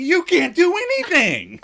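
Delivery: very high, loud berating; hammed-up furious scold; exasperated scolding. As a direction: scold him in disbelief. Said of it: "Joey berates Chandler".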